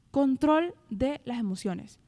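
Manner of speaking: ordinary talk, nothing marked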